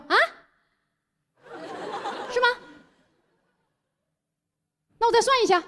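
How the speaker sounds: flustered shopkeeper. Retold or imitated. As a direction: play the caught-out shop owner stalling for time. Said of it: "特别心虚的过去翻那叠纸". (心虚的老板，声音拔高)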